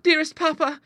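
very high, loud appeal; high girlish entreaty; daughter crying out. A narrator's reading. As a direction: cry out pleadingly to papa. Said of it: "cried Clara".

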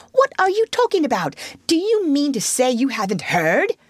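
high, urgent disbelief; greatly excited. A narrator's reading. Rat astonished Toad hasn't heard, greatly excited. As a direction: fire off astonished questions at a high pitch. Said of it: "cried the rat, greatly excited".

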